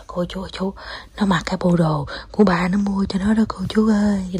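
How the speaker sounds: confidential whisper; whispered aside. Real, not acted. Mother confiding to viewers about dad's purchase, whispered.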